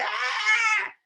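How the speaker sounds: high-pitched crazed yell; mock hysterical "aah"; hysterical scream. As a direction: let out a hysterical scream, acted out. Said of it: "te pones histérica o histérico, así como loco".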